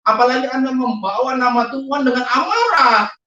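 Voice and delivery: high, forceful retold sermon; impassioned admonition. Man retelling his plea to show God's love, impassioned.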